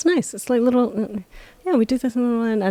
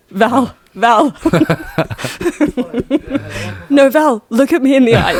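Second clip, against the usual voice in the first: laughing call; 6 semitones higher than usual. Real, not acted. Laughing, calling to Val.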